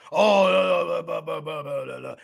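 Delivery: dismissive blah. Retold or imitated.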